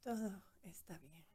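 soft whispered echo; whispered mantra. Real, not acted. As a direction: whisper it back gently. Listener repeats mantra in meditation.

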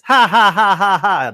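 high, loud cackling laugh; Whoopi Goldberg laugh. Retold or imitated. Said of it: "Whoopi Goldberg goes up there on South Park and says".

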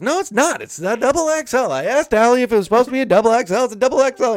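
high, loud, rapid-fire denial; hammed-up quarrel at full volume; worked-up arguing. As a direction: imitate a furious father-in-law shouting back. Father-in-law argues back, acted.

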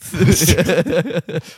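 loud high laugh; burst of laughter. Real, not acted. Laughs at accidental beaver voice.

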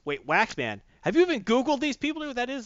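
high, loud mock yell of alarm; acted-out alarmed shouting. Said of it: "Anna starts yelling at the earpiece".

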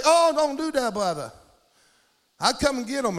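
imitating a Southern stranger's protest; high-starting Southern plea; real Southern accent. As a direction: mimic a Southern local calling out. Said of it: "He had a real southern accent".

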